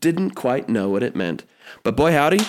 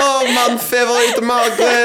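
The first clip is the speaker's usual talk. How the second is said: delighted mock French, pitched up; hammed-up French baker; 9 semitones higher, 4 dB louder than usual. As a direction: gush over it like a proud Paris baker.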